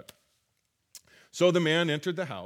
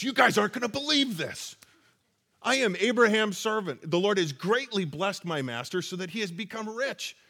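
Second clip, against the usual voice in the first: excited telling; 4 semitones higher than usual. Retold or imitated. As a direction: tell it like you can't hold it in. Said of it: "I gotta think that after everything... he goes".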